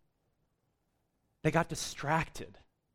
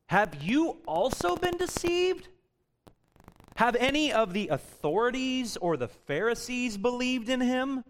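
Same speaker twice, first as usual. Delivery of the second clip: much higher, scoffing accusation; mock them as gullible fools; scornful Pharisees; 7 semitones higher than usual. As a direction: Pharisees sneering at the temple guards, much higher. Pharisees accuse officers of being duped.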